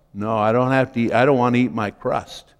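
mock reluctant child. Voices children refusing their crusts.

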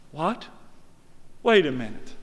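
ordinary delivery

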